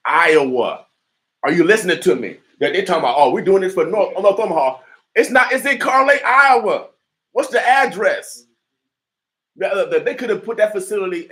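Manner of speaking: loud, high, heated rant; fired-up shouting. Real, not acted. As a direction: preach it at full volume, outraged. Fired-up preacher ranting about facility.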